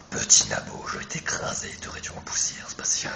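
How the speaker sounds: low whispered villain threat; big villain voice. Acted. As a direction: growl it as a menacing boss. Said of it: "Je vais prendre ma grosse voix que je sais bien faire". (grosse voix de méchant chuchotée)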